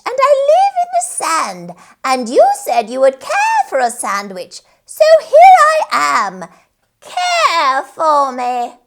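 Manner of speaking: cackling creature voice. A narrator's reading. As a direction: gloat like a little witch. Sandwich creature speaks.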